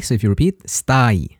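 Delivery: usual speaking voice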